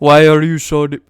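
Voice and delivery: attempted Indian accent, half-hearted; a weak try at an Indian accent. Shown as a demonstration. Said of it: "I can't do an Indian accent".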